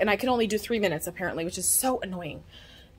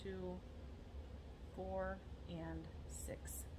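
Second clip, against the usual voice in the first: whispering; 3 semitones lower, 22 dB quieter, much slower than usual. Real, not acted. Counting shirts under breath.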